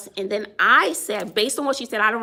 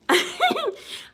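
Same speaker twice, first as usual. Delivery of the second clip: short high chuckle; brief real giggle; laugh. Real, not acted. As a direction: let out a quick high laugh. Laughs after greeting a viewer.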